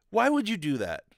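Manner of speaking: usual speaking voice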